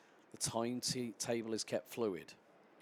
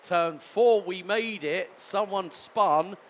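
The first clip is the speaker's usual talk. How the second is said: louder, higher excited race call; live play-by-play; 5 semitones higher, 9 dB louder than usual. Real, not acted. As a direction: call the start as it unfolds.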